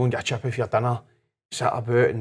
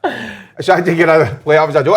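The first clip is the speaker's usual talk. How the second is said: higher, louder laughing defence; playful excuse; 5 semitones higher, 8 dB louder than usual. Retold or imitated. Husband defending himself to his wife, higher and louder, laughing. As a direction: brush it off cheekily. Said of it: "I'm like".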